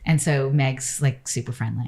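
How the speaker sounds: ordinary talk, nothing marked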